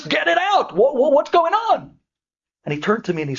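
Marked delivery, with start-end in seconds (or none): 0.0-2.0 higher and slower, pressing for the answer; anxious demand. Retold or imitated. Patient urges the doctor to tell her the news, high and urgent. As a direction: demand to know what's wrong, urgently.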